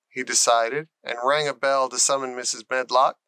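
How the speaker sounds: ordinary delivery, nothing marked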